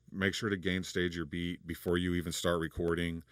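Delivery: ordinary talk, nothing marked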